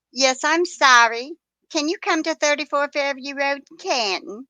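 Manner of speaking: sarcastic dramatized caller; smart aleck mock caller. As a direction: play the flustered caller with a wink. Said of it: "I just had to be a smart aleck".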